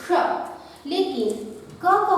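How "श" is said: श said crisply with a faint k before sh; ksha demo. Shown as a demonstration. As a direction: demonstrate the sound श, k barely heard. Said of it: "इसका उच्चारण होता है".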